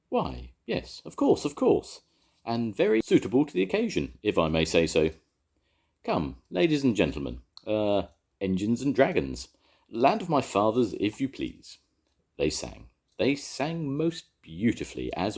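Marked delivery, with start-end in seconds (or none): 0.1-11.8 high, polite, a bit fussy host; courteous old Welshman inviting everyone; polite choirmaster. A narrator's reading. Evans graciously inviting engines and dragon to sing, high and polite. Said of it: "remembered his manners".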